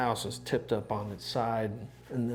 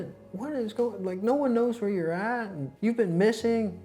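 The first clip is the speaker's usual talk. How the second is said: high, loud scolding mother; motherly grilling; 12 semitones higher, 5 dB louder than usual. Retold or imitated. Woman scolding Jeff motherly after he went missing. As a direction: grill him like a worried mother. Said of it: "motherly starts kinda grilling him".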